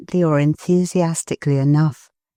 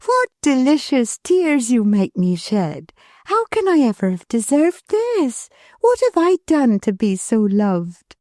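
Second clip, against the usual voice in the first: put-on higher, gentle young wife's voice; Milly, tender; 8 semitones higher than usual. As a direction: lovingly reassure her husband. Milly moved to tears.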